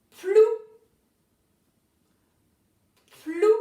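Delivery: "plou" set apart, both consonants distinctly sounded; dictated syllable. Shown as a demonstration. Teacher gives the next syllable to write, carefully articulated. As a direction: dictate "plou" slowly and clearly. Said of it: "c'est la syllabe".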